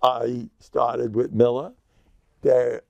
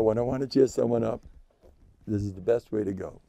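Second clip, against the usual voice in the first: ordinary talk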